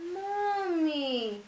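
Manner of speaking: put-on a small child saying his first word into the phone; toddler voice. Toddler on the phone saying mommy to grandma, drawn out. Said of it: "Say 'mommy'".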